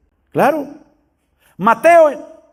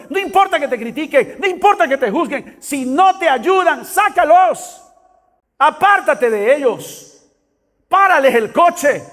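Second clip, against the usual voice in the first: raised, driving preacher's exhortation; impassioned preaching; 6 semitones higher than usual. Real, not acted. Preacher urging the congregation to shed hindering company, impassioned preaching. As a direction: preach it urgently, stretching the key commands.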